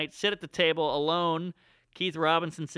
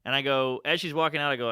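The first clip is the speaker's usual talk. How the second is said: normal talking voice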